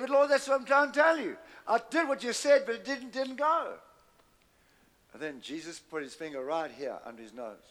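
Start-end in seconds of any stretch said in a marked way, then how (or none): 0.0-3.8 high, pleading voice of a frustrated man; protest, bewildered and high; man arguing back with the Lord. Acted. Hagin protests to Jesus.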